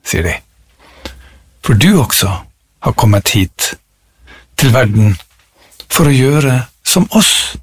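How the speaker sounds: measured poem recital with long pauses; poetry reading. Acted. Reciting a favourite tree poem to listeners, poetry reading. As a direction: let each phrase of the poem settle.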